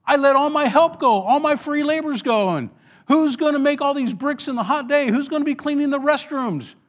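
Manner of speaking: high-pitched, indignant outburst; snapping Pharaoh. Acted. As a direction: rant like a king who suddenly regrets it. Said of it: "Pharaoh just snaps and says".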